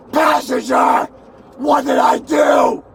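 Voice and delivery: real angry shouts at the police driver; shouting. Real, not acted. Arrested passenger yelling at the silent driver, angry. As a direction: yell to make them answer.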